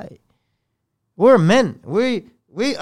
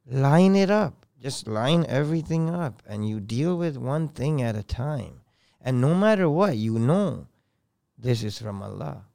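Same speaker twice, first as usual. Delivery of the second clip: father's calm advice; 5 semitones lower, 7 dB quieter than usual. Retold or imitated. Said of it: "I heard my father".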